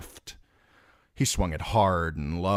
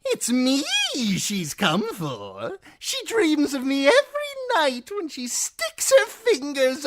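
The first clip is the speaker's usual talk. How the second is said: high, loud, mocking jester voice; cruel jester; 7 dB louder than usual. A narrator's reading. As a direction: taunt the wounded man with glee. Shagwell boasting lewdly.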